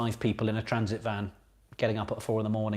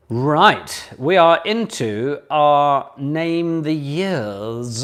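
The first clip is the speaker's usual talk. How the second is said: announcer voice; 5 semitones higher, 11 dB louder, much slower than usual. Acted. Announcing a new round.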